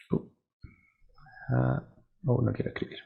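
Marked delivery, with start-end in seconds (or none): none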